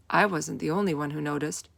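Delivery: ordinary delivery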